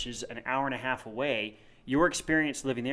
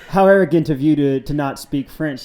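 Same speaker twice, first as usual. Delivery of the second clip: mock snooty Parisian; 3 semitones higher, 11 dB louder than usual. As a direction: scoff at him like a snobbish Parisian waiter. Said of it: "they're like".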